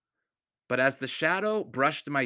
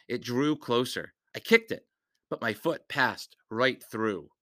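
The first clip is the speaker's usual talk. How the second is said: ordinary delivery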